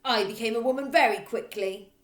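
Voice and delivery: loud barking rant; loud bossy headmistress. Acted. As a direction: hammer every line like a bully. Trunchbull voice.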